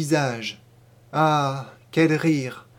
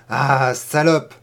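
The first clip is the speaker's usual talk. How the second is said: slow, louder repeat with a rise on salope; louder insult; 2 semitones lower, 5 dB louder, slower than usual. Acted. Boy pinning the girl down, repeating it louder. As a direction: push the repeated insult harder. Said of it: "ne cessait-il de répéter".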